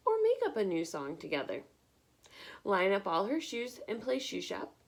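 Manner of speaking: lively picture-book read-aloud; bright, expressive storytime reading; reading to children. Acted. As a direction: read it like storytime for little ones.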